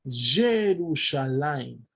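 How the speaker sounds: Jerusalén stretched out slowly; drawn-out reading. Shown as a demonstration. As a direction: read Jerusalén slowly off the inscription. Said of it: "donde dice". (Jerusalén leído muy despacio)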